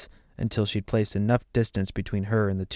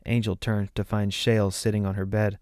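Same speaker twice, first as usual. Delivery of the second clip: usual speaking voice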